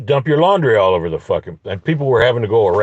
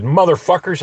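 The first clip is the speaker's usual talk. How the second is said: higher, mild re-enacted yell; half-volume angry holler; retold yell; 7 semitones higher than usual. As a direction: call it out angrily without full volume. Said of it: "I yelled at him".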